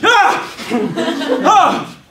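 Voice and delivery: high, loud scream on action; actor's scream. Shown as a demonstration. Overacting actor screams on action, high and loud. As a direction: blast a wild scream.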